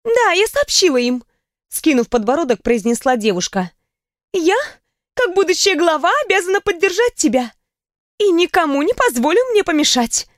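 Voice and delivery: proud future head of house; determined young woman. A narrator's reading. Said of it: "столько решимости и внутренней силы".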